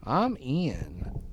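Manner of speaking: muffled, hand over mouth. Real, not acted. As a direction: speak through your hand. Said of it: "you sounded really muffled when you said that".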